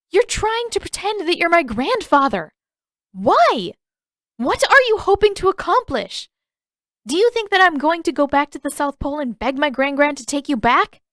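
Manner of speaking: incredulous Katara. A narrator's reading. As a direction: stammer out your outrage.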